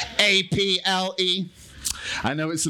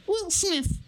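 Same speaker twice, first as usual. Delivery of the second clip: very high squeaky voice; small squeaky answer; squeaky puppet voice. Pretend.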